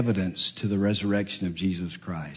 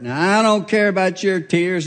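mock stern dad; 7 semitones higher, 6 dB louder than usual. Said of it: "and I'm lying the whole time".

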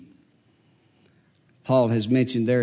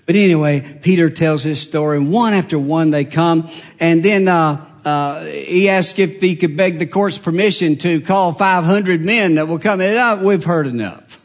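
louder, driving preacher voice; preaching; 5 semitones higher, 9 dB louder than usual. Acted. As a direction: push the story forward from the pulpit. Preaching at full force.

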